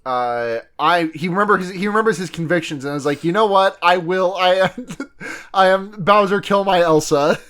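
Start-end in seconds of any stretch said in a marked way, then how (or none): none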